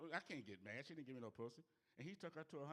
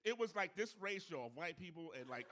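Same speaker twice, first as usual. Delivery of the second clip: loud, higher, fired-up storytelling; loud riled-up telling; 4 semitones higher, 8 dB louder than usual. Real, not acted. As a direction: tell it like you're back in the crowd.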